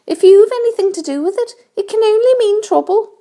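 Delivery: high, fast complaining voice; grumbling earthworm. A narrator's reading. Earthworm predicts trouble to centipede, fast high grumble. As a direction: grumble quickly at a high pitch. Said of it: "muttered the earthworm".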